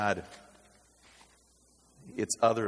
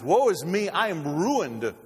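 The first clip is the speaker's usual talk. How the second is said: loud, high cry of woe; loud acted lament, pitch leaping up; woeful cry; 7 semitones higher, 7 dB louder, slower than usual. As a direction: cry out in doom, dragging the last words. Acts out prophet's cry of woe.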